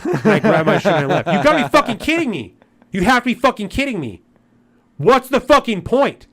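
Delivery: real anger boiling over; furious rant. Real, not acted. Ranting about the store clerk.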